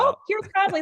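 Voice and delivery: high, mock-shocked gasp of a line; mock surprise. Coach mocking a surprised team member, high and shocked. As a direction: play it as if conflict were a shock.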